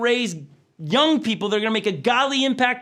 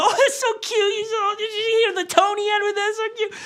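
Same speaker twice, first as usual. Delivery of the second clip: put-on much higher, laughing baby-talk coo; cooing parent; 12 semitones higher than usual. Parent charmed by the two-year-old saying no, cooing with a laugh. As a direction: gush over the toddler like it's adorable.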